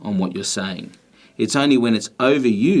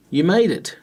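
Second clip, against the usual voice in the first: surprised exclaim; 9 semitones higher than usual. Shown as a demonstration. Surprised friend greets someone arriving unexpectedly, delighted exclaim. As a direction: greet a guest you never expected. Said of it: "and you exclaimed".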